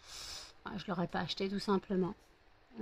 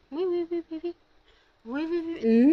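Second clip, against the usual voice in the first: high excited yes yes; cooing to dog; 8 semitones higher, 6 dB louder than usual. Real, not acted. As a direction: coo at the dog, higher.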